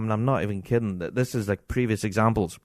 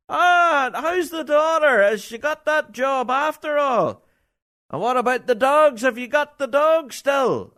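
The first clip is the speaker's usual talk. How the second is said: high, loud hammed-up shout to a far-off friend; mock shouting across arena; 3 dB louder, slower than usual. A man shouting to his friend Stevie mid-performance, high hammed-up yell. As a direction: bellow across the arena at an old mate. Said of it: "He shouts over".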